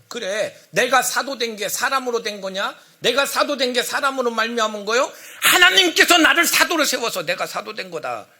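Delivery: indignant questions rising into a loud declaration; angry apostle. Acted. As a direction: defend your calling with mounting anger. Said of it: "완전 막 화가 나가지고서".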